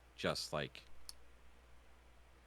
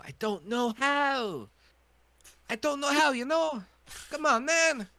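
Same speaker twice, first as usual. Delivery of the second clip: high pitch voice; 7 dB louder than usual. Shown as a demonstration. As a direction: squeeze the voice up high. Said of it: "a high pitch".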